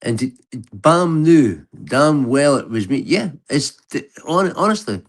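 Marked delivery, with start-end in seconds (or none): none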